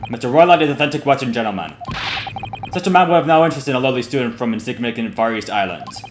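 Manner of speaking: lightly voiced Russian guard, haughty; a hint of a Russian sailor looking down on them; light Russian accent. Guard dismissing the murdered student, light Russian accent. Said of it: "me voicing him is also a little bit stereotypical".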